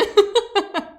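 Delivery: high, loud burst of laughter; amused laugh. Real, not acted. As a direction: laugh out loud at your own slip. Laughs after correcting her own pronunciation.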